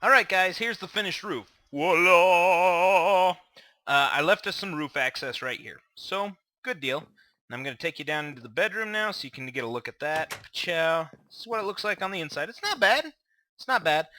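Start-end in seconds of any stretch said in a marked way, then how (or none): 1.7-3.3 showy voila. Real, not acted. Presenting the finished roof. 12.5-13.1 high, questioning not bad; high rising. Real, not acted. Says it twice, first rising high.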